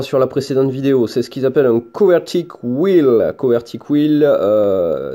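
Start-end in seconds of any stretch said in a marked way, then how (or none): 1.8-4.2 English words in a Toulouse French accent. Real, not acted. Presenter naming a saber accessory for viewers, French-accented English. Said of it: "désolé pour mon accent anglais qui est en plus doublé de l'accent toulousain".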